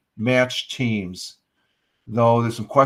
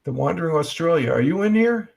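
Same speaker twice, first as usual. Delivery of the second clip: raised-pitch hopeful question to the chat; high calling out; 6 semitones higher than usual. Real, not acted. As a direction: call up high to find him.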